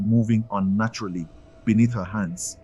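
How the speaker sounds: plain reading voice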